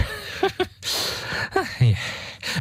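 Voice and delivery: breathy high mocking cackle. Demon laughs at clueless Heinrich, breathy cackle. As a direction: cackle at his ignorance. Demon mocks paralysed man.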